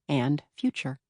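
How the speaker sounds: ordinary delivery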